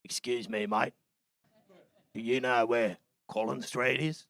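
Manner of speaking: low, quiet mock Aussie drawl; Australian accent. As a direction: imitate a deep-voiced Australian asking directions. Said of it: "this fella comes up to me".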